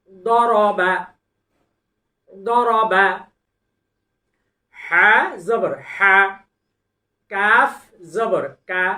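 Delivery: loud, deliberate syllable recitation; Quran recitation drill. Shown as a demonstration. Quran teacher reciting syllables for students to repeat.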